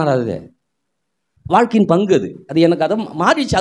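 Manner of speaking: normal talking voice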